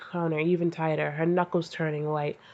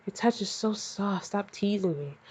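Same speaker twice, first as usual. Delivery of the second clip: soft moan; 3 semitones higher, 7 dB quieter than usual. A narrator's reading. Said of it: "Hinata moaned".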